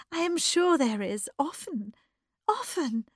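high, fervent agreement; high and emotional, softening on often; overcome with feeling. Acted. Bertha wanting to cry out to Eddie, high fervour. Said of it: "She wanted to cry".

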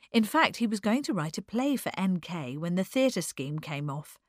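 ordinary delivery, nothing marked

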